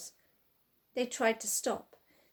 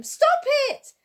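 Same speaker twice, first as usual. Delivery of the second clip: shouting in pain; 10 dB louder, much slower than usual. A narrator's reading. Man attacked, shouting for help.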